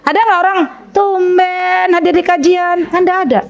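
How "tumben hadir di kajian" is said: high, slow, drawn-out snide jab; mock needling greeting; ugly intonation. Shown as a demonstration. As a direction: stretch "tumben" like a catty friend. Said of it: "Intonasinya tuh jelek gitu loh".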